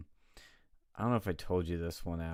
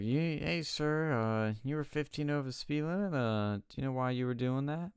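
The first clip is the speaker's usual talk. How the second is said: higher, brisk lightly voiced cop; officer voice; 7 semitones higher than usual. Officer telling the speeder his speed, lightly voiced cop. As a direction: state the violation like a traffic cop. Police officer at traffic stop.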